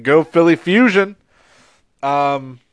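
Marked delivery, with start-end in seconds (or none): 0.0-1.2 cheering. Real, not acted. Cheering for team.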